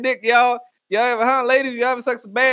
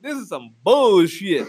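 ordinary delivery

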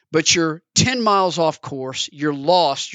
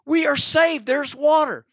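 much higher, overjoyed shout of relief; thrilled stranded traveller; excited cry; 10 semitones higher, slower than usual. Acted. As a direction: cry out like you've found rescue. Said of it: "someone's looking at it going".

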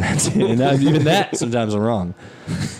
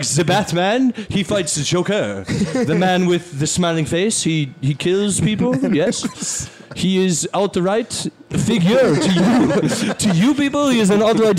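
put-on European accent; 5 semitones higher than usual. Imitating a European explaining Batman.

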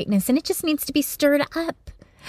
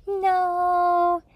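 put-on little girl; 7 semitones higher than usual. Little daughter answering her dad, high childlike voice.

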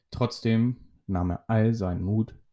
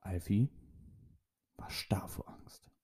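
hushed, breathy whisper; 3 semitones lower, 14 dB quieter than usual. A narrator's reading. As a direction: drop to a frightened whisper. Scared moment, narrator hushes.

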